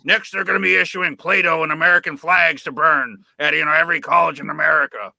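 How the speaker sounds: loud, ranting radio-host voice; mock Alex Jones rant; Alex Jones impression. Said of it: "as Alex Jones likes to say".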